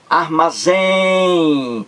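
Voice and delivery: "Armazém" with a very long, high "zém"; stretched last syllable. Shown as a demonstration. Teacher giving an example of the rule, drawn-out "zém" in "Armazém".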